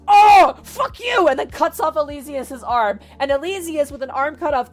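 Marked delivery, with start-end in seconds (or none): 0.1-1.3 loud, high retold yell; imitated outburst of pain and anger; enraged orc shout. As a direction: cry out as the wounded orc. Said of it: "the orc is like".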